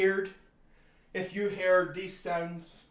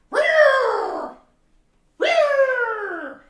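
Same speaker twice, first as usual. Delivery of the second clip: very high, loud shrieking roar; creature roar; 10 dB louder than usual. Retold or imitated. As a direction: screech it out like a wild beast. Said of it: "they sound like".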